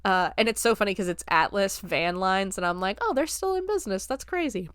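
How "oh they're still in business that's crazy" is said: mild surprise. Retold or imitated. Mock reaction to old company name.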